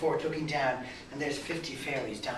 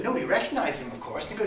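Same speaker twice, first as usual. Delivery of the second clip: plain reading voice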